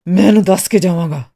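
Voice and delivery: loud, heated insistence; angry. A narrator's reading. Artist determined to tell Miss Martha off, loud and angry. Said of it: "ਉਹ ਗੁੱਸੇ ਨਾਲ ਬੋਲਿਆ".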